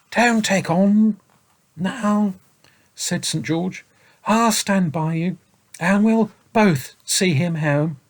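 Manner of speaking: slow, soothing knightly voice; Saint George comforting. A narrator's reading. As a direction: reassure the boy gently.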